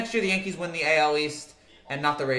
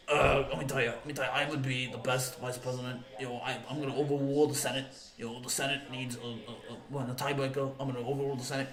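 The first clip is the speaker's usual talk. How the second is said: low, softer radio-host impression; Mike Francesa; 5 semitones lower, 9 dB quieter than usual. Streamer answering as the suggested vice president, low impersonation. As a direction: pontificate in a lower, heavier voice. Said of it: "Mike Francesa should be your V P".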